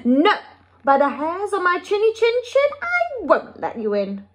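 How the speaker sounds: high rising squeaky refusal; squeaking little pig. A narrator's reading. Second little pig refusing the wolf, squeaking. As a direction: squeak back at the wolf, climbing in pitch. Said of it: "squeaked the second little pig".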